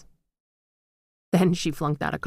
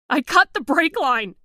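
much higher and louder, desperate yell; shouted confession; 10 semitones higher, 6 dB louder than usual. A narrator's reading. Terrified daughter confessing to the ghost, desperate shout. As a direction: scream out the confession. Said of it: "she shouted".

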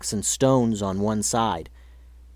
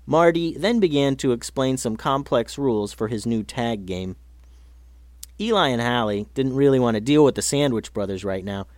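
plain reading voice

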